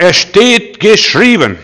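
viel höher, lauter, langsam, steht gedehnt; „Es steht geschrieben“ langsam und mächtig ausrufen; feierlich proklamiert. Shown as a demonstration. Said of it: "Und sag". (laut, langsam und feierlich verkündet)

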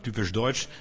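ordinary delivery

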